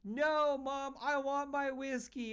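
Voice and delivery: imitating a small boy begging his mom; whiny little kid. Dad imitating the neighbor kid begging his mom for whiskey, high whine. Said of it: "And the kid goes".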